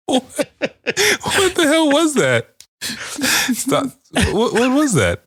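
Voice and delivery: very high, slow squeaky disbelief; high, slow, squeaky what-was-that. Pretend. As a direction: squeal it in mock shock. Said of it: "I'd like to know what that was".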